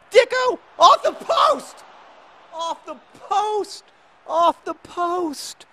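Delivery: very high, slow, loud wail; anguished screaming. Real, not acted. Gamer seeing Dicko hit the post, anguished screaming. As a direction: scream each word drawn out in disbelief.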